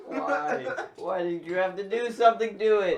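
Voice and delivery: loud, laughing plea; laughing loudly. Real, not acted. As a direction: cry out through a laughing fit. Laughing fit after light falls.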